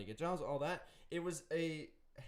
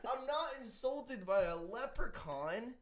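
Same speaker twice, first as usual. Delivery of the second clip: higher, hammed-up offended protest; mock indignation; 7 semitones higher than usual. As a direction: ham up being insulted. Mock offended Irishman ranting.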